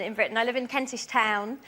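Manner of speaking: normal talking voice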